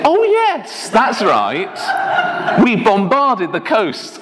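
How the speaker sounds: very high, acted dawning realisation; old man remembering. Ted recalls bombarding Sicily, high mock realisation. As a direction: imitate an old man suddenly remembering, pitched right up.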